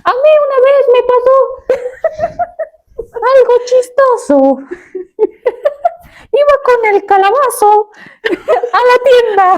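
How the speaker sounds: high, squeaky cartoon pumpkin voice. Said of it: "tienes que hacer voz de calabaza".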